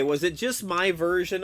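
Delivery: usual speaking voice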